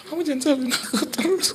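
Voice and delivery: very high mock-crying protest; imitated tearful wife. Wife feels blamed by husband's stare, mock tearful protest. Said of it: "kalau dia, dia nangis".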